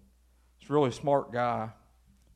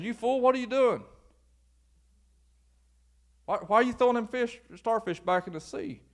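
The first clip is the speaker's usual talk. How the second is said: scornful onlooker; 8 semitones higher than usual. Acted. Mocking man in lounge chair.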